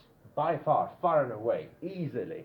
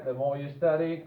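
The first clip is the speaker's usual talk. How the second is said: ordinary talk, nothing marked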